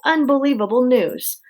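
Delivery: usual speaking voice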